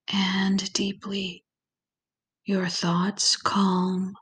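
calm, unhurried visualization voice with long pauses; guided meditation. Acted. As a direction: ease the listener gently into relaxation, lingering on key words.

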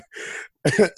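a second embarrassed laugh; real laugh. Real, not acted. Laughing at being an hour late.

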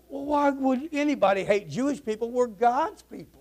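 high-pitched mock affront; acted indignation. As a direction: protest as if wrongly insulted.

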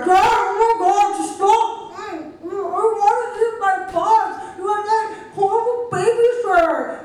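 put-on high, loud whining child; whiny kid voice. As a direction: shriek at dad like a bratty kid. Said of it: "we will play that for you now".

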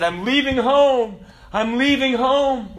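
high, loud, drawn-out mock wail; lamenting cry. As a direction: wail it slowly, imitating a crying man. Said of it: "he was kind of lamenting and crying".

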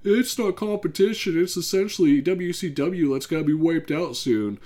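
high mocking impression of a fan. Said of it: "the E-drones will be like".

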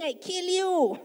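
egging David on to strike now; eager soldier shout. Retold or imitated. One of David's men urging him, hammed-up shout.

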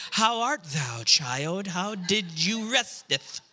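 hammed-up holy King James voices; lofty churchy mock dialogue; mock pious family. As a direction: parody a saintly pastor's household. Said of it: "No, we don't talk like that".

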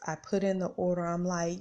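normal talking voice

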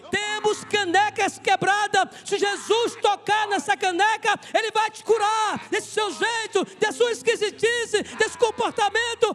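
high, shouted pulpit oratory; full-force preaching. Real, not acted. Preacher telling the church everyone has broken mugs, shouted preaching. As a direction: hammer each phrase out to the congregation.

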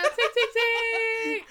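high squealed ting ting ting through laughter; laughing ting sounds. Pretend. Laughing imitation of flute sound.

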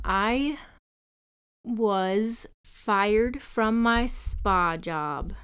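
very slow, deadpan announcement; slow dramatic reveal. Real, not acted. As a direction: spell out the bad news slowly. Slow reveal of being fired.

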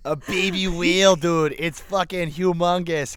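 slow Boston "wheel" for whale; Boston accent. Retold or imitated. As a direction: stretch out a Boston "A baby wheel". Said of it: "saying the word whale as wheel".

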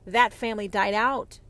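ordinary delivery

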